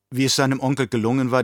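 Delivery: ordinary delivery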